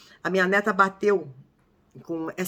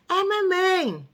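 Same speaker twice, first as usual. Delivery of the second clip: put-on much higher, slow toddler voice; voz de bebê; 11 semitones higher, much slower than usual. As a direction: imitate a one-year-old saying it. Neta de um ano responde. (voz de bebezinho bem aguda e lenta)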